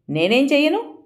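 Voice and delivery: high, confused asking; not getting what he means; bewildered question. A narrator's reading. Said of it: "అయోమయంగా అడిగింది".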